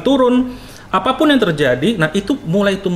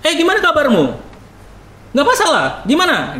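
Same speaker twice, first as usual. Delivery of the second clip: speaking up high; 8 semitones higher than usual. Shown as a demonstration. Preacher showing how some regions talk high. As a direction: let the pitch ride up, it's normal. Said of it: "ngomong ke atas itu nggak masalah".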